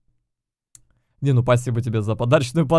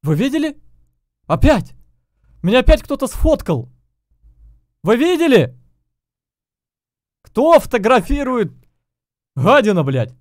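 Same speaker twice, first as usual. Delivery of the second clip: high, loud indignant yelling; raging gamer; 8 semitones higher, 3 dB louder than usual. Real, not acted. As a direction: shout at the screen in outrage. Getting photographed in-game, yelling.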